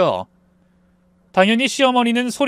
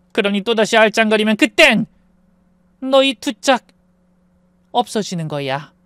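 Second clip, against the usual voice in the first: controlled, measured menace in short phrases; threatening mother; 5 semitones higher than usual. A narrator's reading. Mother warning husband away from her daughter, controlled threat. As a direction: lay out the threat piece by piece.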